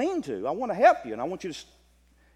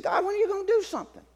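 urgent plea; 11 semitones higher, faster than usual. Retold or imitated. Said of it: "He said".

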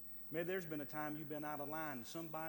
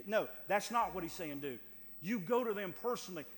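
loud, driving pulpit voice; full-force preaching; 2 semitones higher, 9 dB louder than usual. Acted. Preacher urging the church to confront others personally, loud and driving. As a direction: hammer it home to the congregation.

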